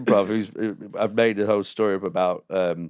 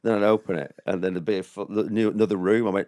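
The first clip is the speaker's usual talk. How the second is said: ordinary delivery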